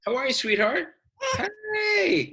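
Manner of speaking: very high, cooing hello. Real, not acted. Cooing at a small child.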